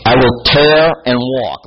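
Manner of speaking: slow loud declaration. Acted. Reading God's threat.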